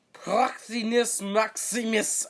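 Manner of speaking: high, loud, drawn-out title; hammed-up booming proclamation, pitched high; grand mock tone. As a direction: proclaim it slowly with overblown pomp. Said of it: "I'm gonna say it in that tone".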